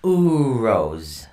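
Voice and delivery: "Uros" with a long held OO, then ROZE; demonstrated pronunciation. Shown as a demonstration. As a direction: stretch the first vowel of "Uros" for learners. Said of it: "it's pronounced O O O dash".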